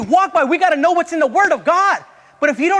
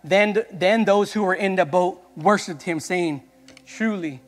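a softer, lower, measured Bible reading; scripture reading; 7 semitones lower, 4 dB quieter than usual. Acted. Preacher reading the Bible to the congregation, hushed and measured. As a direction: read the verses quietly and reverently.

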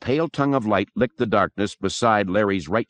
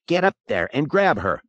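higher, urgent command; impatient order, volume only slightly raised; snapped order; 7 semitones higher than usual. A narrator's reading. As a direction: snap at a friend to act. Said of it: "Pete snapped".

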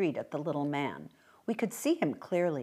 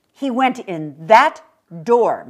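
firm mother; 3 semitones higher, 11 dB louder, slower than usual. Acted. Mother points out the house.

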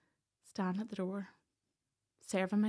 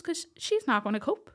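higher, louder imitation of the gruff farmer dad; blunt dairy-man dad; 5 semitones higher, 7 dB louder than usual. Daddy warning the sheriffs on the phone, loud blunt imitation. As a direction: tell them straight, she won't cope. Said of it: "typical Northern Ireland dairy man".

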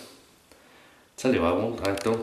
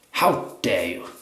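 snob indignation; slower than usual. Retold or imitated. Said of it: "the shaving snob".

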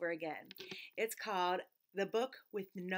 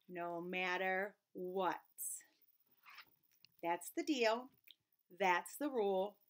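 ordinary delivery, nothing marked

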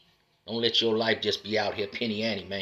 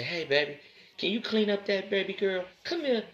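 sweet daddy-to-daughter voice; 10 semitones higher than usual. Shown as a demonstration. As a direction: coo at your baby girl. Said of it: "See how my voice went down?".

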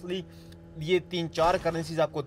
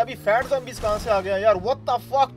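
ordinary talk, nothing marked

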